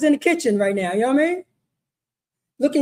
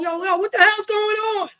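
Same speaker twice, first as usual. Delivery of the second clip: higher-pitched shocked demand; pitched-up, alarmed protest; startled parent; 7 semitones higher than usual. Acted. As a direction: act out a parent walking in, startled. Acted parent walking in on daughter.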